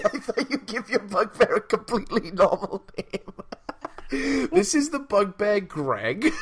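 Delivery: high, laughing incredulity; cracking up while teasing a friend. Real, not acted. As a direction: talk through a fit of laughter. Cracking up at bugbear's name.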